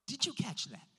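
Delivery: usual speaking voice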